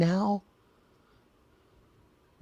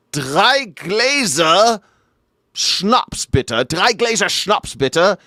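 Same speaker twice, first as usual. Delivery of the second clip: German bar order; 5 semitones higher, much slower than usual. Retold or imitated. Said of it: "and they're like".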